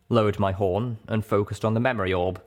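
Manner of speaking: usual speaking voice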